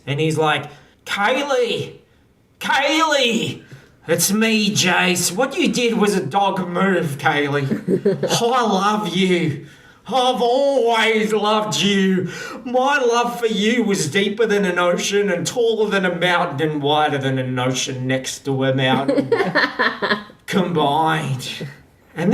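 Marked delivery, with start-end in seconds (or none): 1.1-3.6 high, drawn-out mock bellow; amplified calling out. Jace calling Kaylee from an airship above, amplified calling. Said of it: "he speaks with an amplified voice". 4.1-21.7 raised, projected lovelorn proclamation; amplified declaration. Acted. Jace professing love to Kaylee from an airship, amplified declaration. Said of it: "he speaks with an amplified voice".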